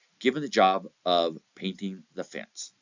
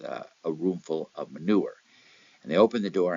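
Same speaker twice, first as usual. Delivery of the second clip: normal talking voice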